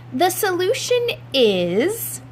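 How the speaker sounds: high, slow grand build-up; announcing mom. Acted. Mother announcing her plan to the family, high and slow. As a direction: drag out the big reveal.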